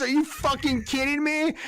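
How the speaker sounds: high-pitched incredulous rant; outraged disbelief. Retold or imitated. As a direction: shriek it like you can't believe it. Outraged at Matrix ending.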